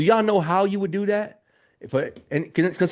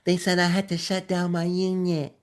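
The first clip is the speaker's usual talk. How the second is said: mock whine, softer; whiny complaining student; 4 dB quieter than usual. Mocking a student whose unit got shut down, whining. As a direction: whimper it like a helpless beginner. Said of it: "that's how I think they sound".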